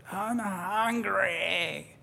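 put-on drawn-out high whiny kid voice; whiny child. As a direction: stretch it out like a cranky five-year-old. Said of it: "So he's like".

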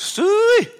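high, loud farm holler; piercing drawn-out livestock call; cattle call. Retold or imitated. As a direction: imitate grandmother hollering for the cows. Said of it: "she called the cows".

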